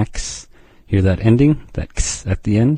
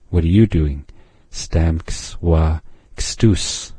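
ordinary talk, nothing marked

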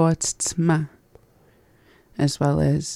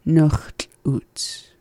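whisper. Real, not acted. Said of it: "how do you say it".

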